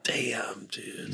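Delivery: breathed out in a near whisper; hushed dude. Real, not acted. Stunned friend answers the sad story, whispered. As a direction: let it out under your breath, stunned. Softly reacting to sad news.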